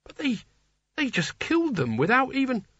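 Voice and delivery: high, far-from-narrator character voice; shocked naive Nigel. A narrator's reading.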